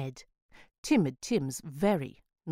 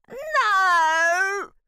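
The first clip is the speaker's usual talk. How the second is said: put-on very high, loud squealing boy; distraught squeal; 8 dB louder than usual. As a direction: squeal in horror like a desperate child. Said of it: "squealed Ned in a distraught voice".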